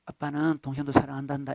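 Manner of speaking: father quietly consoling the elder son; soothing father. Acted. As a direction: coax a hurt son gently. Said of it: "달래주는 우리 하나님".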